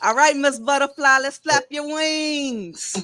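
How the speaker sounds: hype host call. Real, not acted. Host hypes next poet.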